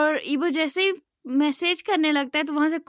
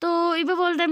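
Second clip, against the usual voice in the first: ordinary delivery, nothing marked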